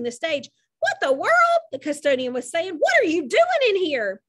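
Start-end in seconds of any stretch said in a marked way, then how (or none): 0.8-1.6 high-pitched shocked exclamation; startled custodian. A narrator's reading. Custodian finding someone on the stage, startled. 2.7-4.2 raised, louder startled question; startled demand. A narrator's reading. Custodian confronting someone in the school, startled.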